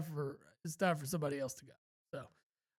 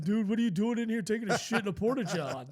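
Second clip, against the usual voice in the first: mock yell, higher and louder; retold yelling; 5 semitones higher, 5 dB louder than usual. Crowd yelling at the guy leaving the Porta John, mock yell. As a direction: heckle the guy stumbling out of the stall. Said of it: "other people yelling at him".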